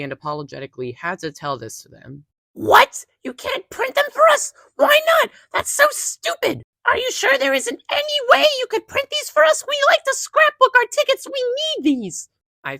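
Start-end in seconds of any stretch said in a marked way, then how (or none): 2.5-12.3 very high, loud whiny mock customer voice; complaining customer. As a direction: squeal it like an entitled customer.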